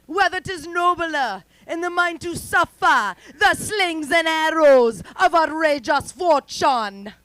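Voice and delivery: put-on slow, loud, high Jamaican-accented theatrical delivery; Shakespeare with a Jamaican accent. Comic showing off her Jamaican-accented Shakespeare, loud and slow. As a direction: declaim the soliloquy grandly in a Jamaican accent. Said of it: "Wanna hear a little bit of my Shakespeare?".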